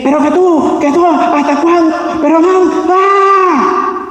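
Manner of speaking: high-pitched quarrel yelling, breaking into a scream; mock shouting match. Shown as a demonstration. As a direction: act out a couple's screaming row, ending on a long yell. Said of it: "Usted entiende a lo que se refiere gritería". (gritería de pelea fingida)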